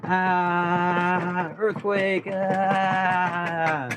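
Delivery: long high wail of pretend alarm; mock panic. Streamer reacting to earthquake button, hammed-up panicked wail. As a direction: hold a high cartoonish cry through the shaking.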